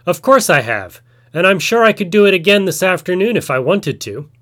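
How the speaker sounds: a spider boasting lightly to the chief; boastful. A narrator's reading. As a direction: puff up and show off a little. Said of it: "puffed out his chest and boasted".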